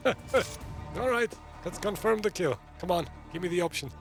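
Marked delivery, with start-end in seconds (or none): none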